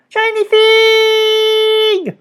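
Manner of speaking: very high, very slow goblin wail; eager goblins. A narrator's reading. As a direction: stretch it out greedily. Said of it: "the goblins cried eagerly".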